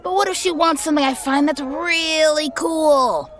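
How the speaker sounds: high whiny complaint; complaining. Acted. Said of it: "The griffin complained".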